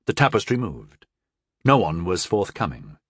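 plain reading voice

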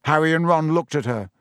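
plain reading voice

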